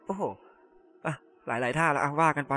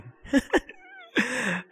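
a sudden high laugh that falls away; real high-pitched laugh; high burst of laughter; 12 semitones higher than usual. Real, not acted. Laughs at duplicate photos.

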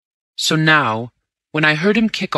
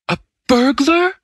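much higher and louder, aghast; horrified gasp; 13 semitones higher, 5 dB louder than usual. A narrator's reading. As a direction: gasp the word in horror. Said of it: "I gasped".

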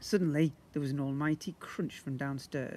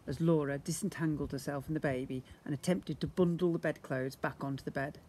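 ordinary delivery, nothing marked

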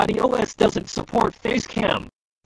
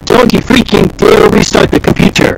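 furious yell; angry shout; 13 dB louder than usual. Acted. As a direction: scream it in a rage. Naughty stops the restart.

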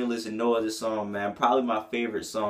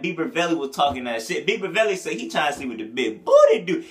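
ordinary delivery

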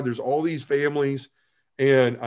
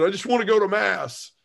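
mock tearful plea, pitched much higher; 9 semitones higher than usual. Upset man pleads with nun, mock tearful and high. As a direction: retell a desperate whine up high. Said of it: "'cause I'm crying and yelling".

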